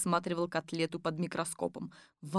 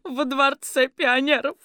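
cheerful granny; 6 semitones higher, 7 dB louder than usual. A narrator's reading. Granny answers.

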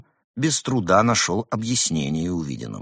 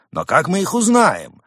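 crowd voices; 10 semitones higher, 5 dB louder than usual. Acted. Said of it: "Голоса".

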